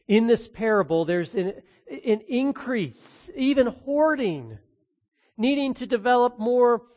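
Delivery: ordinary delivery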